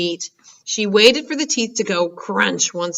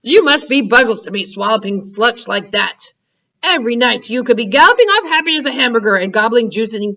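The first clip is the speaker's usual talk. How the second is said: louder angry giant yell; 4 semitones higher, 4 dB louder than usual. Acted. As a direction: bellow in disgust. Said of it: "yelled the Blood Bottler".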